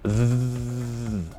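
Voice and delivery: Z buzzed with tongue between teeth; voiced th buzz. Shown as a demonstration. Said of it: "put your tongue between your teeth".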